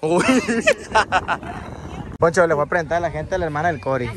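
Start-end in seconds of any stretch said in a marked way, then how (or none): 0.3-2.1 laughter. Real, not acted. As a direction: burst of laughter. Laughs at the kid's answer.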